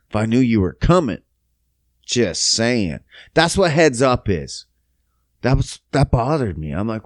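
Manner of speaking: ordinary talk, nothing marked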